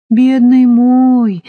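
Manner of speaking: slow, high cooing to a dog; habitual tenderness. Acted. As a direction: coo to the little dog. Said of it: "привычно произнесла Полина". (привычно-ласково)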